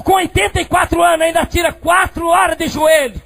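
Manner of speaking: high, driving preacher's shout; full-force preaching. Acted. Preacher telling of embracing his praying mother, high shouted preaching. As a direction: push each phrase out high and loud.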